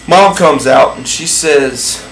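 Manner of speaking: ordinary talk, nothing marked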